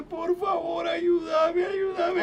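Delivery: high, pleading cry for help, acted out; yalvaran yakarış. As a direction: plead desperately in a high, strained imitation. Acts out someone begging in tears.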